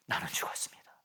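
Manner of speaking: 나는 죽었습니다 breathed in a whisper; hushed vow, 나는 죽었습니다 almost silent; whispered confession. Shown as a demonstration. Repeated confession, first whispered.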